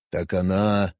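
cool, calm alien; Vulcan voice. Acted. Vulcan Kos.

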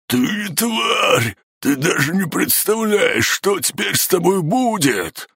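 furious pained scream. Acted. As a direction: scream threats through the pain. Guard screams threats in pain.

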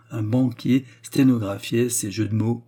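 usual speaking voice

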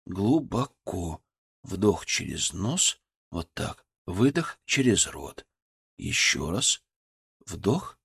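slow, soft breathing guidance; soft spa whisper. A narrator's reading. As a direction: coach her breath gently and slowly. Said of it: "мягким, успокаивающим голосом".

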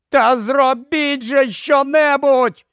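high, slow, pleading yell; frantic yelling. A narrator's reading. Ed yelling at the indifferent crowd. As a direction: scream at them to act. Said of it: "репетував він".

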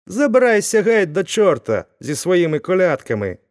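the blacksmith shouting through the door; angry shout. A narrator's reading. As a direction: drive the caroler off. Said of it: "сердито закричав Вакула".

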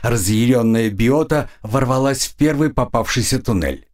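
ordinary delivery

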